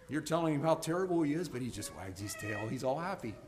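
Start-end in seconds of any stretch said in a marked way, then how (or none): none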